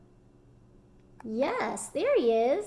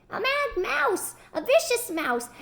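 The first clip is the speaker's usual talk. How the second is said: hollering; 9 semitones higher than usual. Acted. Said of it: "she ran hollering".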